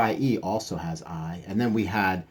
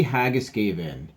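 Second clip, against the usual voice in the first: ordinary delivery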